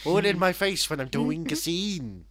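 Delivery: high, loud mock Welsh rant. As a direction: yell it in a hammed-up high Welsh accent.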